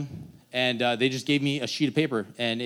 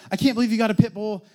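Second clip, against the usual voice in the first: high, fast, disbelieving mom; exasperated mom; 8 semitones higher, 3 dB louder, faster than usual. Retold or imitated. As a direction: scold the son in disbelief. Mom scolding about the dog.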